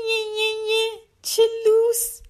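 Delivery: put-on high-pitched taunting jeer of a boy; childish mocking. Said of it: "آرمن شکلک درآورد و گفت". (با ادا و تمسخر بچگانه، زیر)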